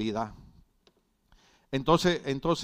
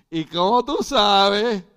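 indignant retort; 7 semitones higher, 9 dB louder, slower than usual. Retold or imitated. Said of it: "Y yo la miré y le dije".

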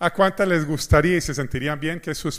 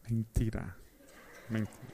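soft aside; 5 semitones lower, 14 dB quieter than usual. Real, not acted. Confessing the lie softly.

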